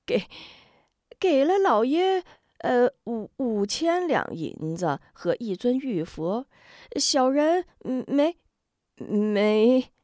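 high, halting, terrified stammer; a coward tripping over his own answer; stammering in fear. Acted.

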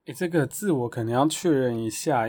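usual speaking voice

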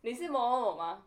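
curt, loud stern caller; mock stern question; stern official. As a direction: play the stern admissions officer. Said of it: "他就这么凶哦".

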